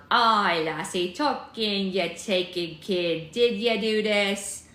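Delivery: loud, slow Scottish figurehead; Scottish accent. Acted. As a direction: draw it out in a big Scottish brogue. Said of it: "heard a Scottish person talk like this".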